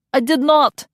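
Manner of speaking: high loud indignant protest; offended denial. A narrator's reading. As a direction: snap back in denial. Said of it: "Yori protested".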